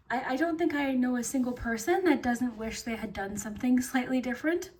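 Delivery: usual speaking voice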